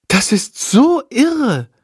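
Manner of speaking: drawn-out wonder at the clever bat; amazed. A narrator's reading. Girl marvels at the bat, slow amazed voice. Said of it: "staunt Lilly".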